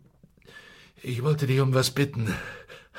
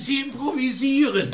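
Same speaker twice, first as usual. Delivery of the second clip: sharp shouted jab, pitched high; scornful shout; 11 semitones higher than usual. Acted. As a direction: fling it at him as an accusation. Cop shouting scorn at partner.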